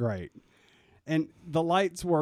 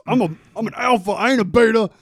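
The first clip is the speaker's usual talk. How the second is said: loud, high, rushed alpha bluster; mock emphatic; 7 semitones higher, 11 dB louder, faster than usual. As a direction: overstate it with hammed-up insistence. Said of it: "I guess I don't have to emphasize".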